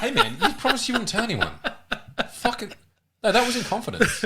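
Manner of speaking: high-pitched, laughing protest; playfully wounded, voice pitched right up; mock betrayed. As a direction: protest as if your secret got out, amused. Joking mock betrayal over prostate check.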